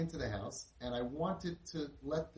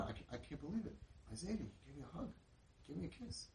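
amazed, going on and on; 2 semitones higher, 10 dB quieter, faster than usual. Retold or imitated. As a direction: imitate a boy repeating his news in hushed disbelief. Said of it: "he's, like, going on and on".

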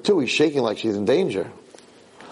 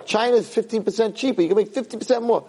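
pitch to dad; 9 semitones higher than usual. Retold or imitated. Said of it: "I said".

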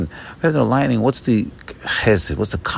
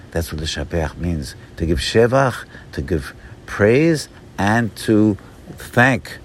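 usual speaking voice

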